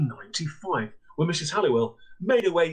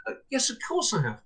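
a higher, polite landlady voice; 8 semitones higher than usual. Retold or imitated. Guest house owner answers the Australian caller, higher polite voice. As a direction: answer like a proper northern landlady.

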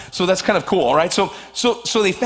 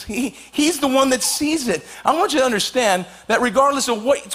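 ordinary talk, nothing marked

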